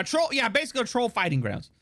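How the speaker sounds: ordinary delivery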